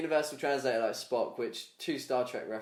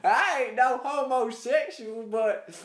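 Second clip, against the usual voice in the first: much higher, very slow hammed-up yokel; hillbilly abductee; 10 semitones higher, 7 dB louder, much slower than usual. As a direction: protest like an indignant hillbilly. Continuing hillbilly bit.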